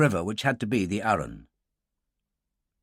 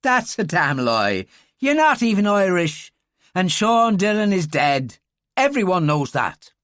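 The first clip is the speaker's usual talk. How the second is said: put-on higher, louder scornful Irish girl; 6 semitones higher, 5 dB louder than usual. Girl calling the stranger a liar, scornful Irish voice. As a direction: throw it back at him, disbelieving.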